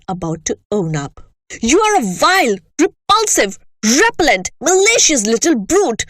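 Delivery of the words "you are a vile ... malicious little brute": louder, higher raging tirade; shouting. Acted. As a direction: hurl each insult. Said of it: "the Trunchbull was shouting".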